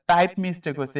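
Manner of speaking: ordinary talk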